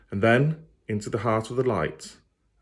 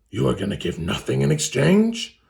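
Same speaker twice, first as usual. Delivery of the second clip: louder, incredulous demon voice; scoffing fiend; 5 semitones higher, 4 dB louder than usual. Acted. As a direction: scoff at her.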